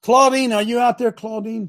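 calling out for Claudine. Real, not acted. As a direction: hail her like she's far off. Calling out to an online viewer.

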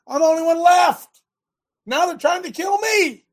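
high, loud plea of a hunted prophet; desperate Elijah. Acted. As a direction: cry out as the last one left. Elijah's desperate complaint retold.

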